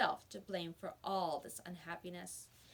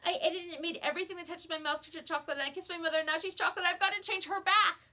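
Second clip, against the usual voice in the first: fast, rising higher and louder to a near shout; a panicked boy pouring it all out; babbling in panic; 9 semitones higher, 9 dB louder, faster than usual. A narrator's reading. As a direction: tumble the words out faster and faster. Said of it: "he babbled on".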